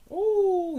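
high loud whoop of joy; ecstatic whoop. Real, not acted. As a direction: burst out in joy.

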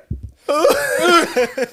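loud, high laugh, nearly crying; helpless laughter. Real, not acted. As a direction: lose it laughing. Laughing at his acted scene.

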